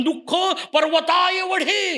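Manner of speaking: high, slow, stretched mock wail; mock crying out. Preacher mimics people yelling over their sorrow, mock outcry. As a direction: cry it out as if complaining hugely. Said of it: "दुःख मिळालं का एवढं म्हणून ओरडता".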